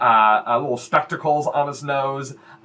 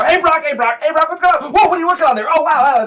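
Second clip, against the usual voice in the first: put-on high, loud teenage goblins; overexcited little brothers, pitched way up; excited goblin voices; 7 dB louder, faster than usual. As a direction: gabble like a pack of excited kids. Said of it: "like, excited goblin voices".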